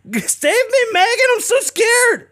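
very high, squeaky mock whimper; mock him as a frightened crybaby. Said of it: "He's like".

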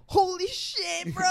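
slow, high amazed; slow high astonished bro; mock shock. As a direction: drag it out in disbelief. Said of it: "he was just like".

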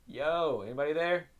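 high call toward downstairs. Real, not acted. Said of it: "I hear noises downstairs".

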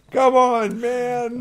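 high, loud mock complaint; mock exasperated. Joking about loot odds with co-host, loud mock exasperation. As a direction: throw up your hands, playfully.

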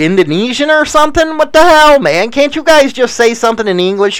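high, fast, mock-outraged complaint; exasperated rant. Real, not acted. Mocking foreign place names.

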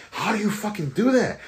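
high-pitched mock outrage, imitating a beaten player; pissed-off wife. Husband retelling his wife's reaction to losing, mock pissed-off. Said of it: "that pisses her off".

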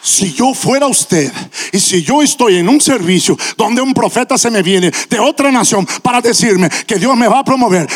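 ordinary talk

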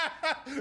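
high burst of laughter. Real, not acted. Laughing at impression.

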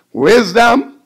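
ordinary talk, nothing marked

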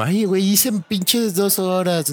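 high, slow whiny driver voice; mock complaining about traffic; whiny complainer. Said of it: "no es aquí como". (queja chillona y lenta)

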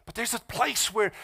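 ordinary talk

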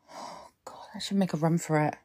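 fast, breathless whisper while hiding; frightened whisper. Acted. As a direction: whisper it fast so he can't hear. Hiding from the stalker.

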